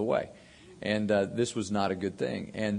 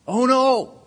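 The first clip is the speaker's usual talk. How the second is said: shrieking "Oh, no!" much higher and louder in mock alarm; hammed-up panicked uncle cry; dramatic uncle; 10 dB louder than usual. Uncle over the phone, horrified about the rat poison, shrieked much higher and louder. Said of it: "My uncle's very dramatic".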